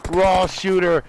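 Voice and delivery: energetic esports caster voice; play-by-play. Acted. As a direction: call the fight live, fast and keen. Live casting of a PUBG match.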